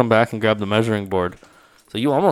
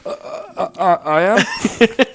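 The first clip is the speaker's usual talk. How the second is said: slow, higher, hesitant mock stammer; nervous stammer; 9 semitones higher, slower than usual. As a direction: stammer it out like you were caught. Rake Man caught off guard.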